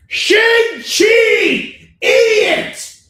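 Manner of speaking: sudden very high, loud yell, slow and stretched; mock furious shout. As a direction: blow up at the idiot who says it wrong.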